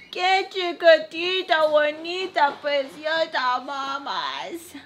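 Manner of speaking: high, slow, drawn-out cooing. Real, not acted. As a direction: gush sweetly over a tiny one. Breaks off to coo at baby.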